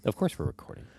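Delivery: breathy playful whisper. Real, not acted. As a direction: whisper it like a secret. Teasing whisper to co-host.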